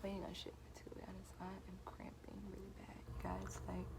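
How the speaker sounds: unvoiced whisper, hiding in the back room. Real, not acted. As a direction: whisper so no one out front hears. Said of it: "So I'm in the back room".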